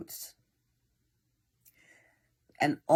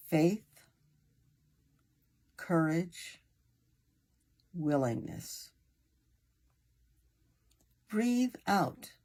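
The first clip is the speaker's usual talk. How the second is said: unhurried meditation guide with long pauses; guided meditation. Acted. Meditation leader easing a listener into breathing and relaxation, guided meditation voice. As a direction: guide them gently, phrase by phrase, leaving room to breathe.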